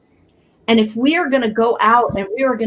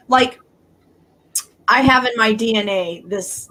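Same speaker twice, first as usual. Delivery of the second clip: ordinary talk, nothing marked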